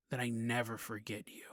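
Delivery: hushed, breathy whisper; whispered vow. Retold or imitated. Demon pony whispers to his dying friend, hushed. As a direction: whisper it like a secret promise. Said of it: "But then he goes".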